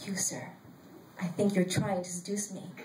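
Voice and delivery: low, soft murmur, voiced; near-whisper. A narrator's reading. Woman leaning over to accuse her seatmate, hushed near-whisper. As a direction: lean in and confide it quietly. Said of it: "I'll lean over to Logos and I'll whisper".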